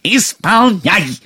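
high, drawn-out ogress command; scary ogress voice. A narrator's reading. Queen cutting off her cook's protest in a scary ogress voice. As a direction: cut off all argument as the man-eating queen. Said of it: "отрезала королева страшным людоедским голосом".

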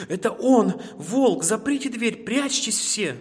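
high, loud cry of alarm; alarmed shout. A narrator's reading. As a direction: shout an urgent warning to the family. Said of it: "закричал заяц".

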